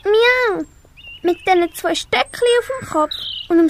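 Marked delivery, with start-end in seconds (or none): 0.0-0.6 high plaintive meow; a child's high kitten mew. Acted. Kitten meows.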